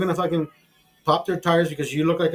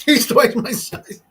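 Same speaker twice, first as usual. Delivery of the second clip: laughing; 8 semitones higher, 4 dB louder, slower than usual. Real, not acted. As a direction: repeat it laughing, louder and slow. Repeated, laughing, for effect.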